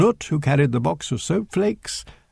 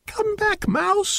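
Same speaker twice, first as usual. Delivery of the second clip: put-on children shouting; slower than usual. A narrator's reading. Children running after the mouse, high shouting. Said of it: "shouted the children".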